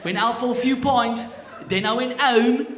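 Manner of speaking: put-on loud, high-pitched British accent; hammed-up British lad, pitched high and loud. Patient telling doctor about the remote, British accent. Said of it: "I'm just gonna get it back quickly".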